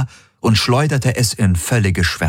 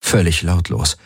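ordinary delivery

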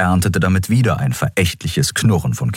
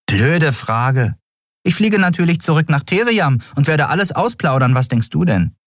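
higher, dry mocking voice; sarcastic reply; 11 semitones higher than usual. Nelen mocks the captain's question, dry sarcasm. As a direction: roll your eyes at the question.

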